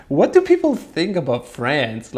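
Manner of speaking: laughing. Real, not acted. Laughing at France adultery line.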